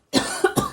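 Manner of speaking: harsh loud cough; coughing fit. Real, not acted. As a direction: cough hard and sudden. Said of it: "my coughing and spluttering".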